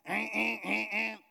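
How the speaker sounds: vocal imitation of a vibrating phone; buzzing phone imitation. Preacher imitating his phone vibrating, buzzing hum. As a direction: buzz in short repeated hums. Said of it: "Started vibrating in my phone".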